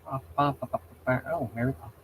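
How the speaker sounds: normal talking voice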